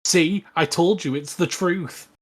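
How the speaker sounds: ordinary delivery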